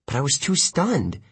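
plain reading voice

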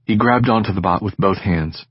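ordinary delivery, nothing marked

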